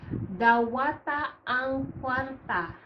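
"Dawata ang kwarta" said slowly, each syllable clear, "dawata" drawn out; slow teacher demo of "Dawata ang kwarta"; slow model reading. Shown as a demonstration. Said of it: "dawata is accept".

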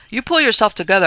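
loud, high, punchy command; forceful urging. A narrator's reading. Urging the ghost again.

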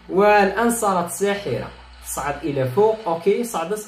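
ordinary talk, nothing marked

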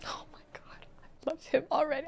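hushed, breathy gush, fast at the end; whisper. Real, not acted. Viewer whispering her crush on the character, quick.

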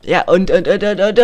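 high, loud excited yelling; excited shouting. Real, not acted. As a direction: yell it out mid-fight. Jubel im Kampf. (aufgeregtes Rufen)

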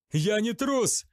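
high, loud indignant outburst; indignant cry. A narrator's reading. Zachariel answers the charge of cowardice, indignant. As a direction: hurl it back, outraged. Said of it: "возмутился Захариэль".

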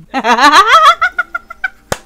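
high, loud cackling laugh; loud burst of laughter. Real, not acted. Gamer cracking up at his Sim, loud high laugh. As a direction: let a big laugh burst out.

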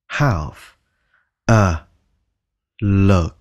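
"Have", "a", "look" separated; word by word. Shown as a demonstration. Teacher splitting the phrase for students. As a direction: give each word its own beat. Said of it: "word by word drill".

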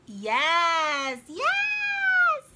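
first yes loud, second a very high squeal; excited yes. Real, not acted. Card collector cheers at her find, squealing high and loud. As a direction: cheer like you just won.

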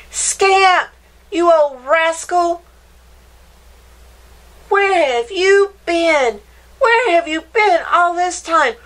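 high, loud excited reunion cries; half laughing, half crying with joy; overjoyed boy. Acted. Boy greets dog overjoyed.

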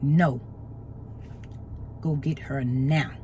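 low, soft, unhurried voice of God; God's voice. Retold or imitated. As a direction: speak as God, deep and calm. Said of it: "And God was like".

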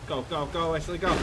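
ordinary delivery

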